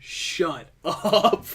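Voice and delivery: drawn-out "shut up" with a laugh; exasperated amusement. Real, not acted. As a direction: groan at an awful pun, stretching each word. Laughing retort to wooder pun.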